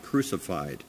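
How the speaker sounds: ordinary talk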